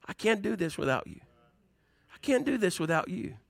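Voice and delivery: quiet, humble plea to God; hushed, earnest little prayer; soft prayer. Pretend. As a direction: drop right down, as if praying alone at dawn. Voicing a morning prayer.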